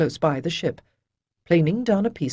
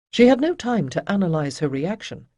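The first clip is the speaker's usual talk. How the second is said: usual speaking voice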